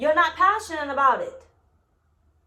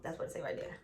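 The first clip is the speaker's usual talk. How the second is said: whispered aside; 8 semitones lower, 15 dB quieter, faster than usual. Real, not acted. Aside reading the card.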